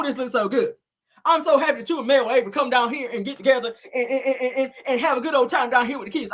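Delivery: high-pitched, gushing country woman; mock a country woman thrilled with everything; Marlene impression. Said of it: "That's Marlene, her country ass".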